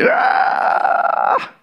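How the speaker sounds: sudden high unvoiced shriek; a child's startled scream, high and breathy; mock child scream. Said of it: "sudah wis jerit".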